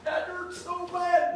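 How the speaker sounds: very high, drawn-out mock whimper; hurt child. Boy crying to his dad over a splinter, high mock whimper. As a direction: play a little kid wailing over a tiny hurt. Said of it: "getting a, uh, splinter in my finger and going".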